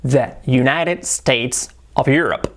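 "United States of Europe" slowly, word by word; slow model with English R in "Europe"; spaced-out "the United States of Europe"; careful English model. Shown as a demonstration. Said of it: "la R la tiene que trabajar".

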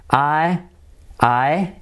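ordinary delivery

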